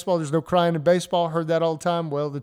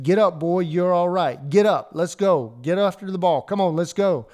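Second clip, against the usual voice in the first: put-on loud, higher barking orders at a fallen kid; retold dad's shout; 4 semitones higher, 6 dB louder than usual. Dad yelling at his hurt son from the sideline, loud tough-love shout. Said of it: "his dad stood up and said".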